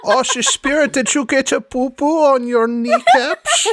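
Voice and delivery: high, laughing mock-sweet tease; teasing Sans Serif. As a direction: coo it with a giggle.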